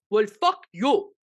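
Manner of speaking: slow, loud imitation of the scammer; slow mock retort. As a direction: drag it out, half-hearted insult. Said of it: "he goes like this".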